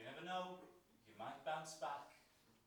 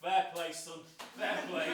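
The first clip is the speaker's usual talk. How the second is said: loud cheer; 11 dB louder than usual. Acted. Cheering on the son loudly.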